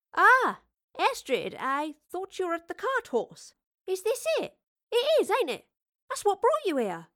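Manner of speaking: put-on high, eager cockney girl; bubbling with excited curiosity. Excited young Astrid voice.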